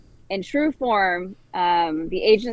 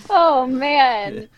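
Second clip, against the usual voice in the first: laughing exasperation; 7 semitones higher, 5 dB louder than usual. Real, not acted. Laughing exasperation over smoke alarm.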